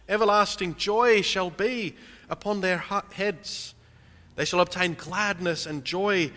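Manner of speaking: impassioned sermon voice; full-force preaching. Real, not acted. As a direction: preach it out over the congregation. Proclaiming Isaiah's highway promise.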